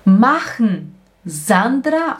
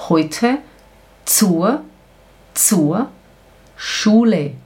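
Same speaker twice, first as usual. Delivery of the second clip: usual speaking voice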